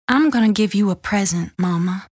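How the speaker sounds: usual speaking voice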